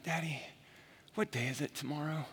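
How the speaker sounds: a hint of a young son asking quietly; soft imitation of a child's question; small child asking. Father voices young son's bedtime question.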